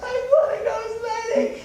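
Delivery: put-on very high, pleading child's wail; squealed, insistent kid voice; whining little boy. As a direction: beg like a toddler who won't give up. Small son begs again.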